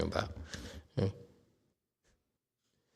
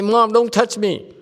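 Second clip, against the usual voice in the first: put-on higher, louder teen protest; teen brushing off mom; 11 semitones higher, 5 dB louder than usual. Teacher voicing a fourteen-year-old brushing off mom, loud. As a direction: push mom away, annoyed teen.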